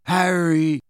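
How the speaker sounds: drawn-out, dazed call; woozy, giddy summons; giggling weakly. A narrator's reading. Dazed boy pulls friend closer, weakly giddy. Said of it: "still giggling weakly".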